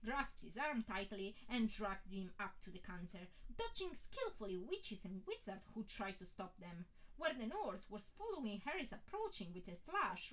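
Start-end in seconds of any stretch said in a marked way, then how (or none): none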